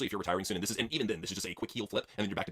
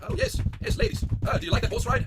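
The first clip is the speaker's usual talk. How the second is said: higher, eager come-on; lusty riding instructor; 6 semitones higher, 3 dB louder than usual. Pretend. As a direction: tease the ladies. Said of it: "impression, I teach Kira Hogan".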